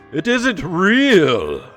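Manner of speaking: ordinary delivery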